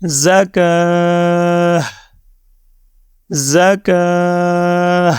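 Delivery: زکا held very long, closed with an h; final ha sounded. Shown as a demonstration. Teacher repeats for learners, زکا ending on a sounded ha. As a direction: draw out زکا and sound ha at the end. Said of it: "ہا کو at the end آپ نے صرف sound کر دیا".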